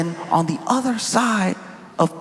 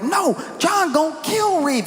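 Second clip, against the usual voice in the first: put-on excited kids; 11 semitones higher than usual. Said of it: "They were like".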